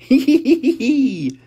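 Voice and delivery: high squealing giggle. Acted. The girls giggling at the baby photo, high squeal. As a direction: giggle like a delighted little pig.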